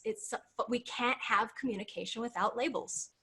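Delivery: usual speaking voice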